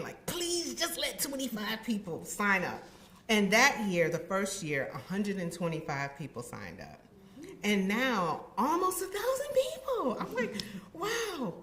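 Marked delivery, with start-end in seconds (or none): 0.3-2.8 higher-pitched mock plea; plead, retelling your own begging; lightly acted, hopeful begging. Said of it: "and I was begging". 8.6-11.5 very high-pitched amazement; awed, playful wow; amazed. Retold or imitated. Amazed at how many took part.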